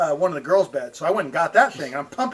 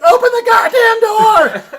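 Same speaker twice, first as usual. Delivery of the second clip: high, loud yell, drawn out; imitated frantic shout to open the door; shouted order; 8 dB louder, slower than usual. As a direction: bellow it across the house.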